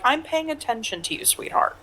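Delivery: lightly voiced flirty line, fading to breath on sweetheart; mock flirty listener. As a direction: play a smarmy flirt, only a hint. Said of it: "or is that, like".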